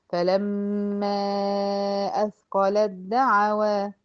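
slow recited فلما أثقلت دعوى, the ت merged fully into the د; slow tajweed recitation with merged ت; Quranic recitation. Shown as a demonstration. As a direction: chant it slowly, ت swallowed into دعوى. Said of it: "بدخل من اللام على الدال على طول".

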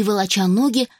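ordinary delivery

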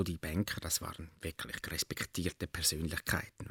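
whispered, reverent aside; hushed awe. Retold or imitated. Storyteller whispers to friend about respected bankers, hushed awe.

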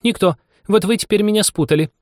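high, exasperated mouse voice; angry mouse. A narrator's reading. Mouse blaming Anya for the muddle, annoyed. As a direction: complain crossly in a high voice. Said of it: "не на шутку рассердилась мышь".

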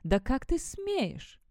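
high, slow outraged shriek; shrill scream. A narrator's reading. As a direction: shriek it at the offender. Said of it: "пронзительно закричала".

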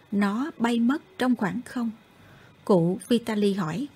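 ordinary delivery

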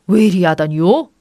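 loud, put-out husband's grumble; annoyed. A narrator's reading. Husband roused from bed by his wife, annoyed. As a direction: complain at being bothered. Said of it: "남편은 몹시 귀찮은 듯".

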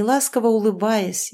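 plain reading voice

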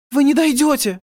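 put-on very high, loud girlish cry; high-voiced protest; chorus of girls. A narrator's reading. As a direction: exclaim as worried girls. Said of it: "сказали все девушки".

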